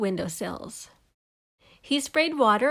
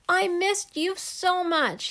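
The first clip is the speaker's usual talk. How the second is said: slow, higher, heartfelt; tender; 8 semitones higher, slower than usual. Acted. Kevin to his mother, slow and tender. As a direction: say it slowly into the hug.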